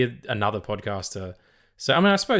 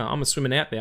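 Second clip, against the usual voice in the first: normal talking voice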